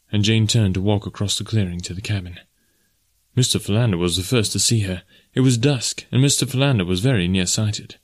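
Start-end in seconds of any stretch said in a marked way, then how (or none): none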